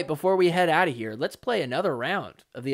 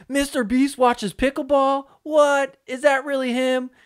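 high, loud incredulous reaction; mock astonishment; 9 semitones higher, 5 dB louder, slower than usual. Host mimicking the live chat reacting to Mister Beast, mock astonished. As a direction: imitate a stunned crowd, pitched way up.

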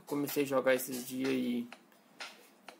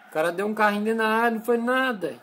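indignant complaint; 6 semitones higher, 6 dB louder, faster than usual. Real, not acted. Complaining about referee.

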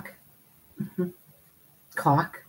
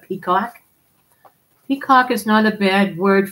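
ordinary talk

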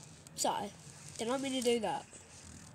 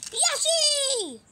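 loud high squeal of a name; high shouted call; 9 dB louder than usual. Pretend. Calling out to Yoshi.

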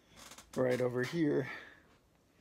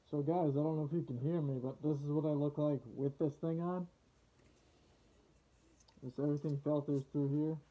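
muffled behind rubber respirator; speaking inside full-face mask; through a gas mask; 4 dB quieter than usual. Real, not acted. Seen 0: Full-face rubber gas mask with filter worn throughout the speech.

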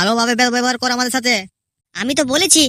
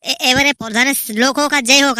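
usual speaking voice

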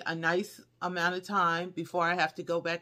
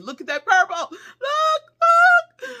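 higher and louder, gushing; breathless thrilled look-look; excited; 11 dB louder, slower than usual. Real, not acted. Thrilled showing the lit angel.

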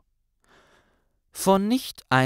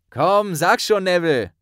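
higher, louder teasing push; grinning tease; 7 semitones higher, 6 dB louder than usual. Acted. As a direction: press him with a grin. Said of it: "hakte Harry grinsend nach". (grinsend nachhakend, lauter)

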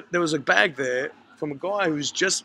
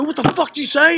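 loud, high, confrontational demand; mock angry shout; aggressive challenge; 9 semitones higher, 9 dB louder than usual. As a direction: bark it like a bloke squaring up. Guys confronting him, aggressive.

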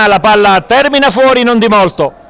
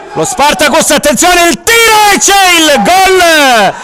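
breathless italian goal commentary; 6 semitones higher than usual. Real, not acted. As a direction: erupt into the goal call. Equaliser goal call.

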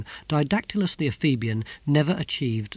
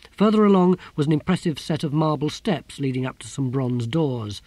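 usual speaking voice

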